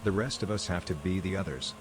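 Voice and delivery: usual speaking voice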